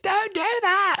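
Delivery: very high, hammed-up pleading; mock high plea. Podcaster mocking Ruby's plea, silly falsetto. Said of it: "Ruby's like".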